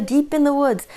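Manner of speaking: ordinary delivery, nothing marked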